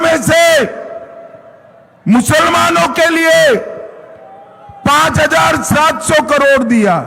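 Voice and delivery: high, shouted rally oratory; booming political rally speech. Real, not acted. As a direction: thunder it out to the crowd. Addresses a rally crowd.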